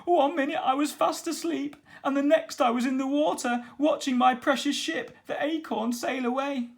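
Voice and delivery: pirate captain telling how his ship was taken; Captain Stubble voice. A narrator's reading. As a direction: play the distraught old pirate.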